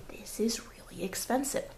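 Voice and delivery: whispered aside, no voice. Pretend. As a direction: lean in and whisper it like a secret. Aside whispered in mock rant.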